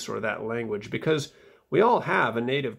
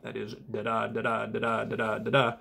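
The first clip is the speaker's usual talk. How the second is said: "da-da" with the second syllable stressed, five times; iambic rhythm; 2 semitones lower than usual. Shown as a demonstration. Showing viewers the iambic beat, steady da-DA. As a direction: tap out five iambic feet. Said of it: "five iambic feet".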